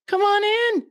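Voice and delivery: very high, sing-song welcome; mock cheerful greeter; welcoming. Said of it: "It was like".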